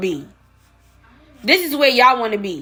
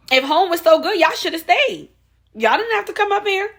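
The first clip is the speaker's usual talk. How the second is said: scornful, higher; 6 semitones higher than usual. Real, not acted. Rising scornful challenge.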